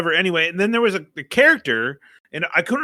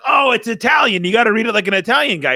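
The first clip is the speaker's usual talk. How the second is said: excited urging; 7 semitones higher, 4 dB louder, faster than usual. Retold or imitated. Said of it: "I was like".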